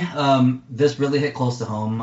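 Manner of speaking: ordinary talk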